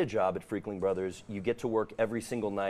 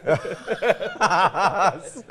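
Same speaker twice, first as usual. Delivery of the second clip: creepy laugh; 9 semitones higher, 9 dB louder than usual. Pretend. Laughing after the scream.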